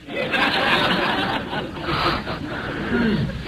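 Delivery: soft airy laugh at his own joke; breathy laugh. Real, not acted. Preacher cracking up at his own fisherman joke, airy laugh. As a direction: laugh quietly, breath only.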